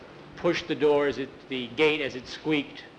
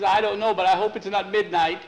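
frightened reply; 6 semitones higher, 6 dB louder than usual. Acted. Frightened boy answers in cemetery.

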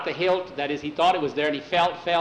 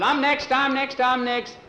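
put-on high, eager child pleading for a turn; eager clamour; 9 semitones higher than usual. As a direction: rattle it out as one excited chant.

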